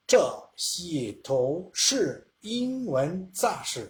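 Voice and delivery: very slow mock Mandarin, syllable by syllable; imitating a Chinese speaker. Teacher imitates Chinese speaker reading aloud, very slow. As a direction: drag out shi shi tou wen za shi like a native coaching. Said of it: "Y ahí le dice".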